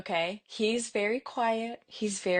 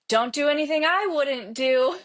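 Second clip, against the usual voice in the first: imitated seven-year-old; 6 semitones higher, 4 dB louder than usual. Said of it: "My seven-year-old turns around and she goes".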